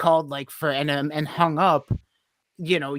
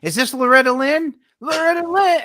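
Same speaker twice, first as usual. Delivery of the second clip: mock prank call; 8 semitones higher, 8 dB louder than usual. Prank calling asking for singer.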